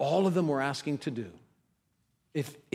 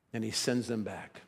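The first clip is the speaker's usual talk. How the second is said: normal talking voice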